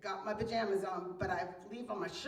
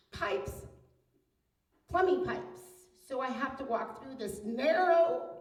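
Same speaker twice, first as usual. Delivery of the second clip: ordinary talk, nothing marked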